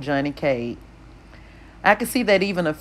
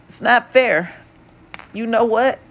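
upset outburst; 7 semitones higher, 3 dB louder than usual. A narrator's reading. Johnny upset.

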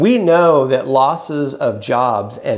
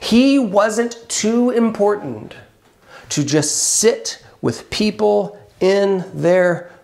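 raised, pressing sermon voice; impassioned preaching; 8 semitones higher, 5 dB louder than usual. Real, not acted. Pastor pressing the congregation about grief, impassioned. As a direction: drive the point home to the congregation.

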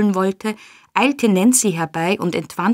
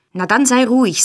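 louder, higher-pitched order; angry command; 5 semitones higher, 5 dB louder than usual. A narrator's reading. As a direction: silence her forcefully. Sikes angrily silences Nancy.

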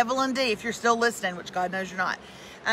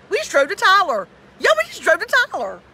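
loud, higher, incredulous; louder exasperated repeat; 7 semitones higher, 8 dB louder than usual. Real, not acted. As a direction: punch it out louder in disbelief.

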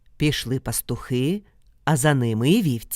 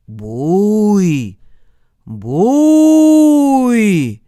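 long howled calls, the second far higher and louder; distant creature call; 4 semitones higher, 9 dB louder than usual. Acted. Dog-headed ogre calling through the forest at night, drawn-out howl. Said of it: "хтось вже ближче крикнув".

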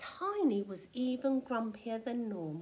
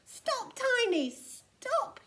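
high, urgent yell; alarmed, high-pitched call; yelled warning; 12 semitones higher, 4 dB louder, slower than usual. A narrator's reading. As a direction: shout to halt someone. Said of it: "yelled Vilo".

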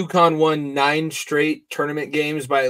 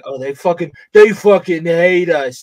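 aggrieved coach, stretched louder and higher at the end; Danny Hurley; 4 semitones higher, 7 dB louder than usual. Acted. Riling up the team as their coach, aggrieved. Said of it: "he's gonna be like".

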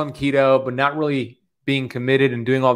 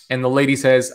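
ordinary talk

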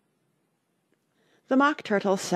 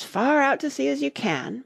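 high-pitched, eager instruction; excited call; 9 semitones higher than usual. A narrator's reading. Excited cries in dance description.

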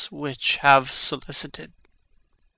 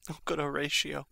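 much softer, pleading; soft dying plea; 10 dB quieter, faster than usual. Acted. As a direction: plead gently, voice failing.

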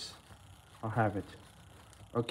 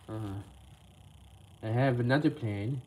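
ordinary talk, nothing marked

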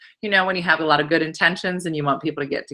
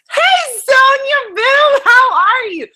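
much higher, louder, slow sing-song greeting; gushing hello to a friend; excited greeting; 7 dB louder, much slower than usual. Real, not acted.

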